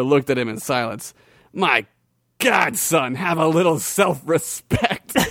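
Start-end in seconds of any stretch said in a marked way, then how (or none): 1.5-5.2 slow, scornful warrior voice; burly warrior. Acted. Warrior sneering at Gregory, slow scornful voice. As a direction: talk down to the kid slowly.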